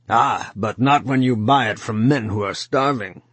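a hint of a big coarse voice; lightly voiced hard city man; coarse large voice. A narrator's reading. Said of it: "his voice came out of him unexpectedly large and coarse".

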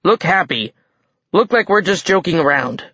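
desperate snarl. A narrator's reading. Giant king coaching mortals to pretend, desperate snarl. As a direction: press them urgently to play along. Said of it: "his tone was a desperate snarl".

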